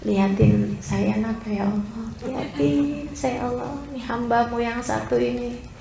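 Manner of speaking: hushed, low, intimate plea to God; pleading prayer. Acted. Lost servant begging God to show the way, hushed pleading prayer. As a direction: plead very softly, as if alone with God. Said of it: "Kamu berdoa manggil nama Allah".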